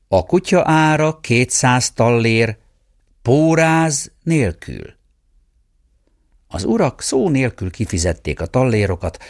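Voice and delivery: ordinary delivery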